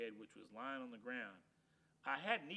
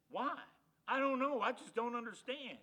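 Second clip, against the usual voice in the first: indignant whine; 8 semitones higher, 7 dB louder than usual. Acted. Dog's indignant complaint.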